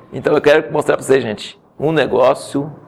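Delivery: usual speaking voice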